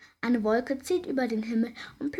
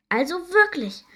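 indignant whisper; 8 semitones higher, 5 dB louder than usual. A narrator's reading. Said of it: "flüstert Erdbeerinchen empört".

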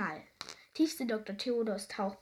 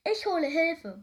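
loud, higher-pitched quick-to-help assurance; eager storybook reassurance; reassuring; 7 semitones higher, 6 dB louder than usual. A narrator's reading. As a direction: promise help with urgency. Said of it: "beruhigte es Tiefsee Doktor Theodor".

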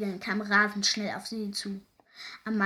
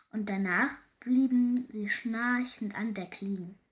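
ordinary delivery

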